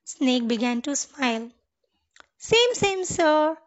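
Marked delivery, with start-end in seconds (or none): 2.4-3.6 delighted clapping. A narrator's reading. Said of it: "clapped Sparrow".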